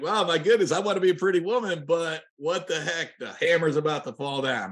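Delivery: high, hammed-up dismay; mock dismay. Listener expecting the hammer to fall, hammed-up dismay. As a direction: play the listener bracing for bad news. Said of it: "It's like".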